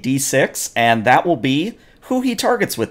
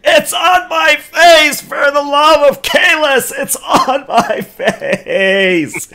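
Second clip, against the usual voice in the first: high, shrieking and half laughing Klingon; panicked Klingon shriek; 4 dB louder, slower than usual. Acted. GM voicing a Klingon with a tribble on his face, high panicked shriek. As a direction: wail it high as if clawing at your face. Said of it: "and he's like".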